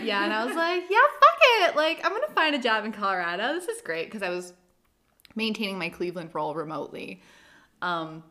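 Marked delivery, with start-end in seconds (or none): 0.9-4.1 loud, high burst of excitement; excited. Retold or imitated. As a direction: shout it like a reckless yes. Retelling excited decision.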